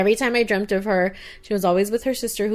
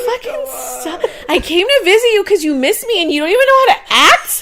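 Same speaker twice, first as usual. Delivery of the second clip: high, loud scolding grandma through laughter; fed-up grandma; 13 semitones higher, 9 dB louder than usual. Retold or imitated. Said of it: "She's like".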